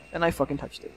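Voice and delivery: ordinary talk, nothing marked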